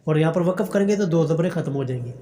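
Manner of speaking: ordinary delivery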